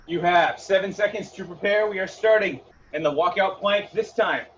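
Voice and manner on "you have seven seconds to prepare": ordinary talk, nothing marked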